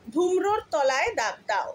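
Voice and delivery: ordinary delivery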